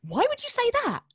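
high-pitched, incredulous self-scolding; appalled at your own blunder; mock exasperation. Comedian berating herself over an audition blunder, exasperated.

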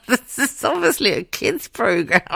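high voice squeezed through giggles; laughing. Real, not acted. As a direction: laugh helplessly while conceding it. Laughing at her own outrage.

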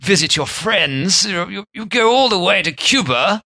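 hammed-up English accent, pitched high. Guest appalled at no gin, mock-outraged English accent. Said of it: "English Baltimore".

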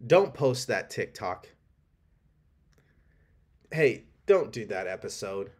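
inner doubt voice. Retold or imitated. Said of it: "when the voice is like".

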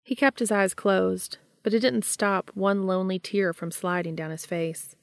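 ordinary delivery

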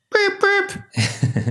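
high-pitched censor beep; bleep sound. Pretend. Bleeping out a swear in the quote, high beep. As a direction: squeak out a bleep over the swear. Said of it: "Do I need to bleep it out?".

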